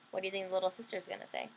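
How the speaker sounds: plain reading voice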